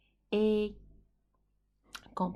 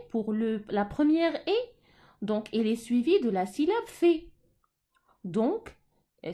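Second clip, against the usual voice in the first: usual speaking voice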